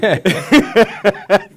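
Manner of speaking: loud, high burst of laughter; big laugh. Real, not acted. Remembering being told he can't do sales, laughing loudly. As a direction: let the laugh explode out. Recalling the boss's blunt reply.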